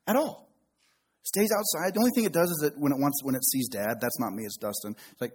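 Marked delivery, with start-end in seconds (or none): none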